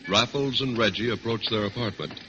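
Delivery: ordinary delivery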